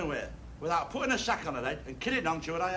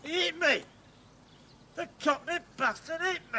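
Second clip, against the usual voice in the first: higher, indignant cry of disbelief; outraged shock; 11 semitones higher than usual. Acted. Hit by the cockney cabbie, he cries out in outraged disbelief. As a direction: yelp it like you can't believe he hit you. Struck by taxi driver.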